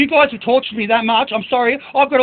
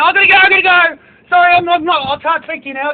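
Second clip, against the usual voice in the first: mock hurried doctor; 7 semitones higher, 3 dB louder than usual. Mimicking doctors rushing off.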